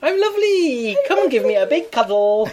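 high, sugary I'm lovely; high sweet voice. Shown as a demonstration. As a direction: sound adorable. S0 demonstrating sweet voice.

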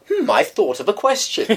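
high, put-on musing voice; mock pondering. Explaining a misused phrase to co-host, high mock-pondering voice. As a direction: act out someone having a thought. Acting out mistaken use of phrase.

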